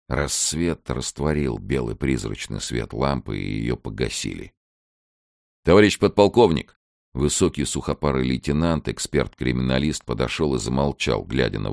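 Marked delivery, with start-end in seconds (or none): 5.6-6.8 louder, higher military address; military report. Acted. Lieutenant addressing the подполковник, crisp military report. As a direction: call the superior officer to attention.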